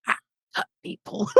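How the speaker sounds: high, soft creepy character voice; creepy Lionel. Acted. Player imagines Lionel leaning forward, creepy. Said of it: "leaning forward going".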